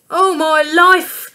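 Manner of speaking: high, loud panicked cry; Dad yelling in alarm, slow and loud; shout. A narrator's reading. Dad shouting at his watch in a panic. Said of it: "shouted Dad".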